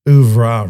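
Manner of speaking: "oeuvre" said as OO-vrah, punched loud; mock-French "oeuvre", OO-vrah, hit firmly; pronunciation demo. Shown as a demonstration. Correcting co-host's French, "oeuvre" loudly pronounced OO-vrah. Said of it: "It's pronounced".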